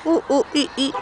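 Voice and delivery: monkey sound. Shown as a demonstration. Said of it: "What does the monkey say?".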